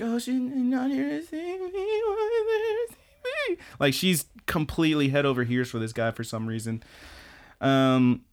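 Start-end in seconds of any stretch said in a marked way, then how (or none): 0.0-3.6 tiny, very high crushed squeal; softening into a drawn-out mock whimper; Karen Tendo. Girl learning he came for someone else, high wail. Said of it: "all the color just drains from Karen".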